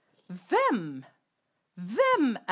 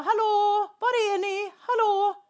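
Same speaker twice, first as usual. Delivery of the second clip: high, loud searching call; God calling out; 11 semitones higher, 6 dB louder than usual. Acted. God calling for Adam and Eve, loud and high. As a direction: call out loud for the hiding pair. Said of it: "Han ropar".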